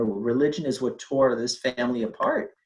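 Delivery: normal talking voice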